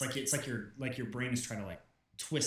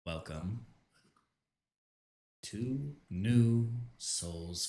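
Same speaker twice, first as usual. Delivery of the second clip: echoey god voice; 3 semitones lower, slower than usual. Acted. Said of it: "a high reverb, like echoey voice".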